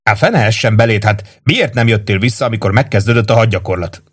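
ordinary delivery

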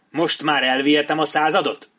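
forceful demand. Acted. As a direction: press a beaten man for consent. Attacker demands company from beaten captain. (hangos, erélyes követelés)

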